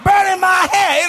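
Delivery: high, loud imitated complaint; mock screaming. Comic imitates women complaining mid-sex, high mock screaming. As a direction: yell it out as a hammed-up screaming woman. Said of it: "They be screaming".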